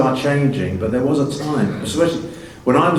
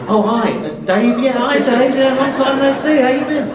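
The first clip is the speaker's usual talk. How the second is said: much higher, falsely bright hello to a half-forgotten relative; awkward cousin greeting; 4 dB louder than usual. Retold or imitated. Actor mimics greeting a cousin seen only at weddings, high and falsely bright. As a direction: chirp a polite greeting to someone you barely place.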